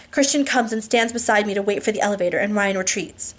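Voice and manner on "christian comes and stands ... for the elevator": usual speaking voice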